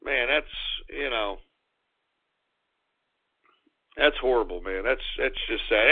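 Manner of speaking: low and soft, subdued over a death; saddened. Real, not acted. Host mourning Charlie Murphy's death, low and soft. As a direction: let it sink in quietly. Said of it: "saddened by a death".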